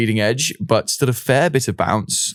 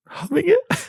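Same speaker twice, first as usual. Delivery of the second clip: incredulous; slower than usual. Real, not acted. Incredulous echo of co-host.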